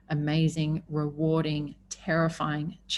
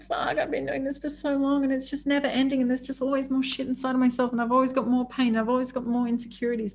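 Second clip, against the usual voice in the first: exasperated; 7 semitones higher than usual. Retold or imitated.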